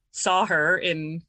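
ordinary talk, nothing marked

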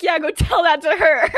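acted indignant outburst; angry retort. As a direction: throw it back loudly in an acted quarrel. Acted quarrel, angry comeback.

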